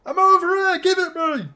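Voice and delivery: high, loud mock shout of players wanting the ball; players calling for the ball. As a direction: squeal like a teammate begging for a pass. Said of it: "all going".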